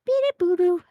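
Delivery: loud playful robot chirp; droid beeps. Pretend. Droid imitation.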